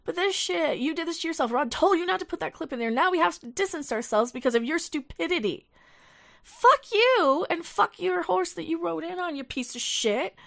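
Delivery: loud, high, cursing tirade; furious callout. Real, not acted. Streamer blasting a rival for his stupidity, furious shout. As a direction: tear into him at full volume.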